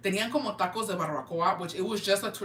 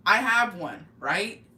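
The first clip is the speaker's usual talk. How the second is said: ordinary talk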